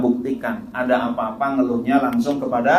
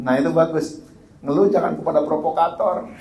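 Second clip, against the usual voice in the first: ordinary talk, nothing marked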